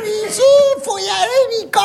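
high mock wife insisting; wife pushing him. Wife coaxing her husband onto the crane, high mock nagging. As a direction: urge him on in a wife's high voice.

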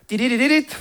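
quick mimicked keyboard chatter; rapid mouth-made keyboard noise; imitated typing noise. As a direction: rattle off typing sounds. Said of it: "Ça donne ça".

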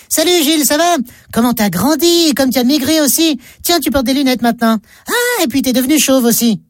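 high-pitched, eager amazement; surprised greeting. Acted. Man greeting supposed friend in street, amazed.

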